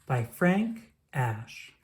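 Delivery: plain reading voice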